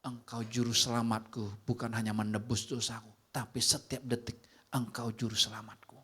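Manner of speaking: a deep, hushed, grateful prayer; quiet prayer. Retold or imitated. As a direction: pray it softly and sincerely. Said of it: "Saya cuman bilang".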